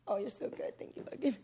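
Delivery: ordinary talk, nothing marked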